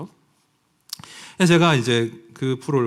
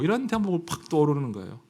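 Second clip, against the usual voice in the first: ordinary talk, nothing marked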